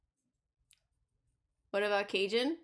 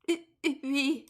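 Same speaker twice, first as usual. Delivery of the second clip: put-on French accent; 4 semitones higher than usual. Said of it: "I keep getting stuck into the French".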